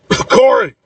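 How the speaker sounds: high-pitched calls broken by coughs; searching shouts through a coughing fit; shouting while coughing. A narrator's reading. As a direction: call out the names high between coughs. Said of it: "shouts while coughing".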